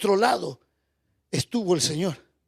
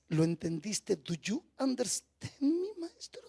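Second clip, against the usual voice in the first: soft, lower, breaking accusation; tearful reproach; 4 semitones lower, 5 dB quieter than usual. Acted. Martha accusing Jesus of coming too late, tearful soft reproach. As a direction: blame him through tears.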